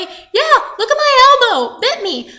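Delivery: high, loud protest; urgent child's pleading; insistent boy. Acted. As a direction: insist at the top of your voice. Boy insists, shows his bite.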